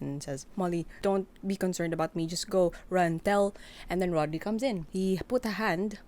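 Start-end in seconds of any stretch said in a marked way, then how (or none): 0.5-3.5 urgent. Acted. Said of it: "rushes to Molly".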